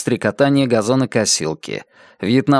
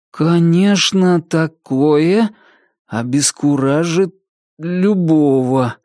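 slow, hesitant, higher voice with stumbles; slow unsure reading; 5 semitones higher, slower than usual. Acted. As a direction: read haltingly, unsure of each word. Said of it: "начал медленно читать неуверенным голосом". (медленно и неуверенно, запинаясь)

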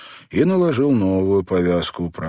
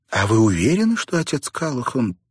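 high-pitched disbelief, lifting on the last word; double-check as if you can't believe your ears; incredulous question; 8 semitones higher than usual. A narrator's reading. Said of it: "переспросила Сьюзан, не веря своим ушам". (недоверчивый переспрос)

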